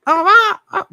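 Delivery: high, loud squawking peacock cry; peacock call imitation. Imitating a peacock's cry for a friend, high loud squawk. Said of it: "They go like".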